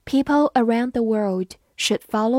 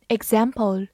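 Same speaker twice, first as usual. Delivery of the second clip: "example" with the American short "a" of "cat"; American pronunciation. Shown as a demonstration. Teacher showing learners the US variant, American pronunciation of "example". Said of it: "美式发音".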